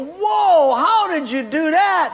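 high, loud astonished cry; amazed. Acted. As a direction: gape at the trick. Amazed onlookers in the joke.

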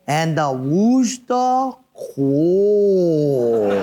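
very slow drawn-out stage narrator voice; Paquet guy's line. Retold or imitated. Pastor mimicking the Christmas musical narrator, very slow and drawn out. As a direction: stretch it out like a bearded actor in a Christmas musical. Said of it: "he is saying".

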